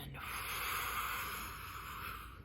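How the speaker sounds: slow audible blown-out breath; demonstrated relaxing exhale; long exhale. Shown as a demonstration. Breathing out on cue.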